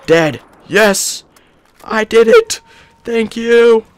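squealing celebration; triumphant cheer. Real, not acted. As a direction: explode with joy at the kill. Kills the tank.

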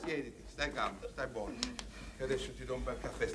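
soft, low calming voice; soothing. Acted. Friend sits him down for coffee, hushed and calming. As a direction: quietly settle someone upset.

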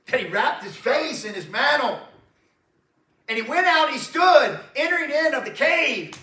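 preacher hammering out the storm verses; full-force preaching. Acted. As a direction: thunder the verses at the congregation.

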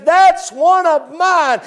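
slow, high, tender voice of God; God claiming his own. Acted. God looking at the saved sinner, slow and high. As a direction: let each word land like a claim. He looks at you and says.

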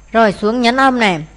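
ordinary talk

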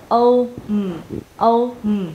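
O, then a separate hummed M; sounds split apart. Shown as a demonstration. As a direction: break "home" into O and a closed-lip M. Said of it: "di chuyển miệng qua âm". (tách rời âm O và âm M ngậm môi)

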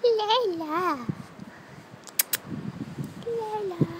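high, drawn-out sweet calling; singsong pet call. Real, not acted.

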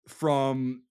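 usual speaking voice

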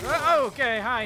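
loud startled yelp; startled shout. Real, not acted. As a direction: caught off guard, loud. Startled by a sudden attack.

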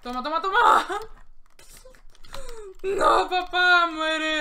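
shooting the enemy, shrill triumphant cry; triumphant yelling. Real, not acted. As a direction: scream it with a cackle as you shoot. Shouting while shooting enemy.

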